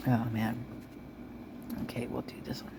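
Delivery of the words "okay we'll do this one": whispering. Real, not acted. Muttering while choosing report.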